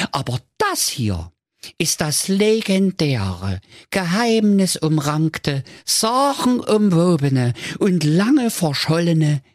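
high, grand announcer build-up; showman count voice. Acted.

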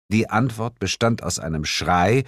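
ordinary delivery

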